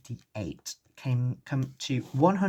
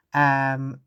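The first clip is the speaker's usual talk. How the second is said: ordinary delivery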